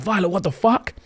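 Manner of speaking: high, rising disbelief; acted-out shock, pitch climbing to the end; shocked retelling. Man walks in on Violet, shocked.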